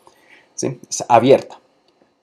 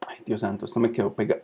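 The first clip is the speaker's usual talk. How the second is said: ordinary talk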